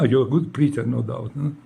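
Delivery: ordinary talk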